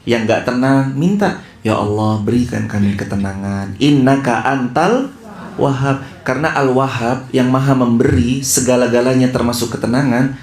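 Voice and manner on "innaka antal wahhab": verse recitation. Acted.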